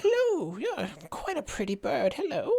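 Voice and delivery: put-on high, wavering old lady voice; frail old lady. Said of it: "small, frail old lady".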